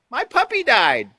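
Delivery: bright high cheer on sad news, pitch way up; cheerful smile on sad words. Shown as a demonstration. Teacher shows the class a mismatched cheerful delivery. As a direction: beam as you say it, mock cheerful. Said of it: "With a cheerful smile, face the clap-, class and say".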